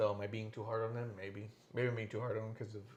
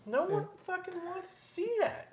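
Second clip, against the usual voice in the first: very high, incredulous protest; exasperated squeal. Real, not acted. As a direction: squeak it out in comic outrage. Mock outrage at the match.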